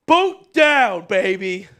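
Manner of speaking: loud, high celebration; hype yell. Real, not acted. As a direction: cheer it like a touchdown. Celebrating finished boot.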